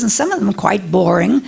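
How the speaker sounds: ordinary delivery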